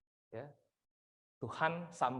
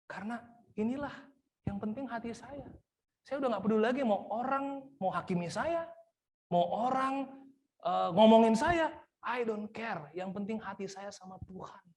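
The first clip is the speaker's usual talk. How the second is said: higher, carefree declaration; David shrugging off his judges, higher; defiant inner voice; 8 semitones higher than usual. Pretend. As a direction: say it like you no longer care what anyone thinks. Voicing David's defiant inner thoughts.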